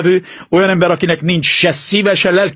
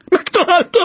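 high, astonished cry; stammered in astonishment. Acted. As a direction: gasp it out, stunned. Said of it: "dadogta elképedve".

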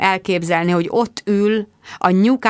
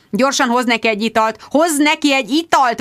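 howling; 6 semitones higher than usual. A narrator's reading. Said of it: "vonította Jude".

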